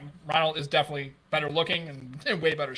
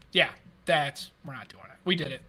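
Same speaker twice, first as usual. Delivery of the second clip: ordinary talk, nothing marked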